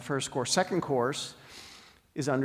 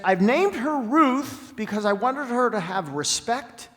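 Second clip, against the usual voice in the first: loud, higher and slow, pressing each word; emphatic; 9 semitones higher, 8 dB louder than usual. Retold or imitated. Vet explaining the dog's name, loud and emphatic. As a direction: declare it with fierce conviction. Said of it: "she was so emphatic about it".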